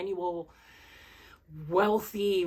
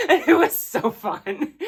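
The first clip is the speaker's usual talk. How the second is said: laughing it out, slow and loud; 11 semitones higher, 12 dB louder, slower than usual. Real, not acted. Reviewer delighted by a book for writers, laughing. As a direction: gush through real laughter. Gushing about a self-aware book.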